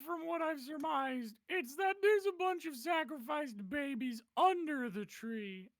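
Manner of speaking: character voice. Acted. Player talking in character.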